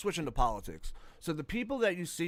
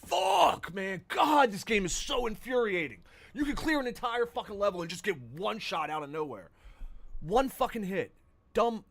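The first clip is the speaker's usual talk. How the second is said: angry gamer rage; 7 semitones higher than usual. Real, not acted. Killed in one shot in game.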